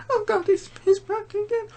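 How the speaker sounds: retelling the lady character afraid of the man; scared lady voice. Retold or imitated. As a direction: squeak it out like a scared old lady. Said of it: "the lady character".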